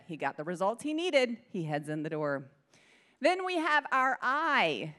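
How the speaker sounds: ordinary talk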